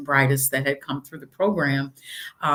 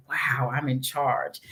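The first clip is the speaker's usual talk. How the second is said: awed whisper of wonder; 4 dB quieter, slower than usual. Retold or imitated. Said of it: "you just see that, like".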